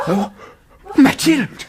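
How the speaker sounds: high, loud cry of her name; alarmed cry. Acted. Father shouts for his daughter in panic.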